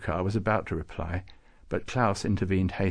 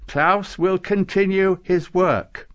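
slow, high, loud intoning; solemn proclamation; 9 semitones higher, 7 dB louder, slower than usual. A narrator's reading. Nicholas announcing Klaus's decision, solemnly intoned. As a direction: proclaim it for the official record. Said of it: "intoned solemnly, just as though he was proclaiming".

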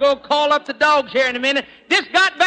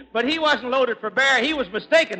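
ordinary talk, nothing marked

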